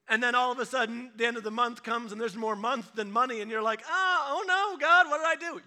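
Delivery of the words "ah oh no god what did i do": high, loud mock despair; mock panic. Broke believer at month's end, mock loud panic. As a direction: wail at God in fake alarm.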